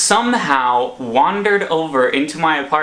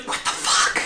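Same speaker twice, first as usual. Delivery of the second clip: shocked, hushed stage whisper; 5 dB quieter than usual. Retold or imitated. Startled sleeper to an intruder in the bedroom, stage whisper. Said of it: "this sort of stage whisper".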